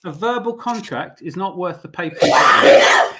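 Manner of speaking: loud, emphatic declaration; emphatic shout. Real, not acted. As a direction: hammer the point home loudly. Heated point in argument.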